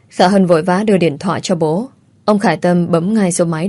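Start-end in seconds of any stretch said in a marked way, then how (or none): none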